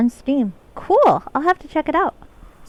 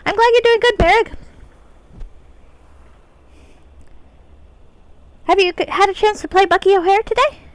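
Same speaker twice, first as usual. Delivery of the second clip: pixie voice; 6 semitones higher than usual. Acted. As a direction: chirp it like a tiny fairy. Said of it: "pixie voice it is".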